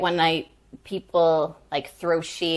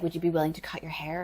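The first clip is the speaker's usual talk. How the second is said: quick, softer imitated question; retold casual request; 2 semitones lower, 9 dB quieter, faster than usual. Director asking actor about a haircut, quick soft request. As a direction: ask it quickly and quietly, in passing.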